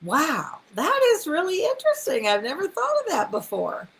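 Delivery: high, bright mock enthusiasm on interesting; interested wow. Shown as a demonstration. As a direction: show delighted interest. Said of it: "Just say".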